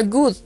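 GOOD con u, "gud"; pronunciación modelo. Shown as a demonstration. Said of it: "y se pronuncia". (GOOD pronunciado "gud", como muestra)

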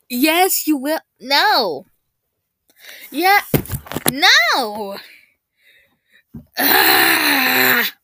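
loud back-and-forth yelling; bickering shouts. Acted. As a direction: yell at each other. Acted quarrel between voices.

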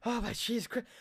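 hushed, anxious aside; quiet panic to yourself while holding a baby; nervous muttering. Retold or imitated.

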